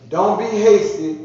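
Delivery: slow, loud and high, leaning on don't; emphatic warning. Real, not acted. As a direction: hammer the warning home, slowly. Preacher stressing scripture line.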